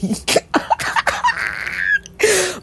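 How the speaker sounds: squealing laugh; high shrieking laugh. Real, not acted. Laugh after mocking quote.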